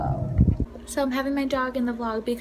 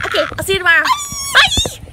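louder, high called-out farewell; shouting goodbye; 6 dB louder, faster than usual. Real, not acted. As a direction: call out goodbye across the room.

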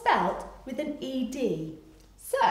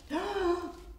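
higher, drawn-in gasp of mock alarm; startled gasp; 9 semitones higher, 3 dB quieter than usual. Storyteller seeing huge footprints, startled gasp. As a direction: gasp as if spotting danger.

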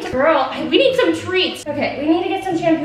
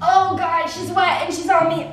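ordinary talk

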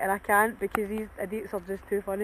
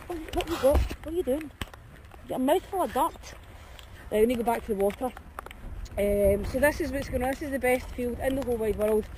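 ordinary talk

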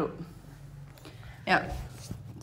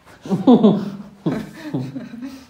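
loud laugh; 7 dB louder than usual. Real, not acted. (tertawa keras)

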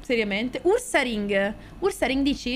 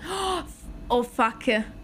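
usual speaking voice

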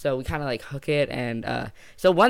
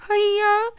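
very high, loud mock cry; silly squealed reply; imitated answering call; 7 dB louder than usual. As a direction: holler it back in falsetto. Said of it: "And then he'd go".

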